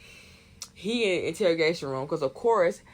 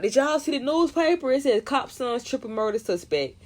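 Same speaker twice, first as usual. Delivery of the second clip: 1 excited gossip; 7 semitones higher than usual. Retold or imitated. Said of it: "They're like".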